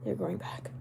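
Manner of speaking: low, hushed whisper; mock whisper. Streamer defending regrowing eyebrows in a mock whisper. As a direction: whisper it as a playful repeat. Repeats it hushed for comic effect.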